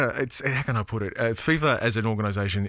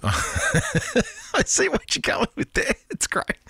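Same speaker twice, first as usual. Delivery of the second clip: laughing through the words, pitched up; 13 semitones higher, slower than usual. Real, not acted. Radio host amused by a listener's text, laughing. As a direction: chuckle as you say it.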